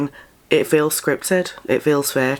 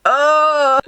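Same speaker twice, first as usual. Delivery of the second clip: high, loud groan of disgust; real squirming disgust; cringing; 14 semitones higher, 5 dB louder, much slower than usual. Real, not acted. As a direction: recoil in cringe, voice shooting high. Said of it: "made me cringe".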